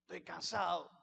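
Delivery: low, soft, worn-out voice; tired sigh. Retold or imitated. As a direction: sound drained after work. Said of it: "Llega del trabajo cansado".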